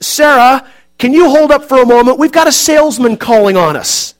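calling out. Acted. Old man calls out to the organist Sarah, loud. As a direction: call over the organ music.

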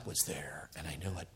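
a breathy hushed whisper; awed whisper. Real, not acted. Preacher confiding to the congregation in a whisper. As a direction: whisper it like a secret of awe. Awe at God's presence.

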